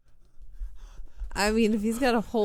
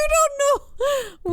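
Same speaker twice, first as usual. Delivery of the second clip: laughing shout; much slower than usual. Real, not acted.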